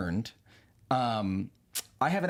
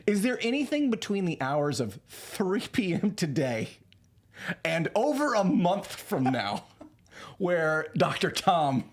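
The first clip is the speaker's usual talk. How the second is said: slow exasperated; 4 semitones higher, slower than usual. Retold or imitated. Speaker pleads slowly with robot.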